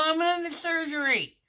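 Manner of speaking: high, loud, fed-up cry; exasperated yelp. Retold or imitated. Patient told to hold it, yelping back in loud exasperation. Exasperated retort about bathroom.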